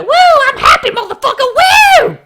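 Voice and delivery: very high, loud, laughing whoop; cartoonish squealing party pony; mock happy cheer. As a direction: shriek with hammed-up glee. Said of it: "I thought he was like".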